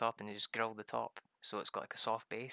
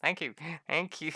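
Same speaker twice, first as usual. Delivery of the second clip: higher, louder squeal of thanks; high mock wife voice; 5 semitones higher, 7 dB louder than usual. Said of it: "She's like".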